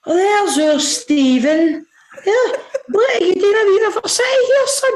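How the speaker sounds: high, slow granny voice, half laughing; granny's voice. Retold or imitated. Granny greets her grandson in the supermarket, high and doting. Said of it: "my granny's like".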